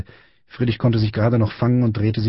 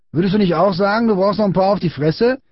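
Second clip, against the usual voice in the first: high, sing-out bully question; goading taunt; 10 semitones higher than usual. A narrator's reading. Big boy goading silent Friedrich, high taunting question. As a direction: needle him into agreeing.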